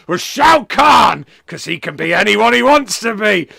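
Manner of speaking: high, loud, stretched-out proclamation; bellowed verdict. Retold or imitated. Wrestler declaring the only correct favourite character, bellowing. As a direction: shout the answer as a grand announcement.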